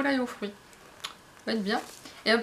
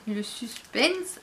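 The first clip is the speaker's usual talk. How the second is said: drawn-out, teasing stretch of the word; mock suspense; much slower than usual. Teasing viewers while opening the box, mock suspense. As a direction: drag it out to tease the viewers. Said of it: "je fais le pseudo-suspense".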